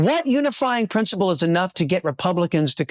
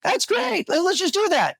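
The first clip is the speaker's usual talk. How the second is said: much higher, breezy mock approval; mock careless voters; 12 semitones higher than usual. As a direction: toss it off like voters cheering on something outrageous. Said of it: "Are they just gonna go".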